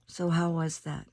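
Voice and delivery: ordinary delivery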